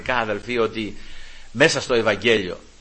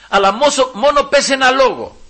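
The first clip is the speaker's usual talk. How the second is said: pleading centurion; 12 semitones higher, 7 dB louder than usual. Retold or imitated. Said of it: "Λέει, «Κύριε".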